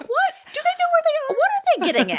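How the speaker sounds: very high, incredulous squeak of confusion; comic bewildered outrage; baffled disbelief. Retold or imitated. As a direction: ask like you can't believe your ears. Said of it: "And I was like".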